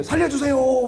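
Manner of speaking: high, drawn-out comic scream for rescue; retold cry for help. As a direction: wail for help as if being swept away. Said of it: "막 소리". (살려달라고 높게 외치는 비명 흉내)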